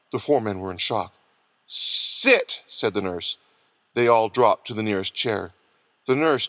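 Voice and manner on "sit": high, drawn-out hissed order; stern command. A narrator's reading. Nurse orders four shocked men into chairs, commanding. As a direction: stretch the word into a sharp command.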